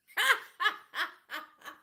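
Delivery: high, loud burst of laughter. Real, not acted. Telling viewers about her husband's profile, laughing loudly.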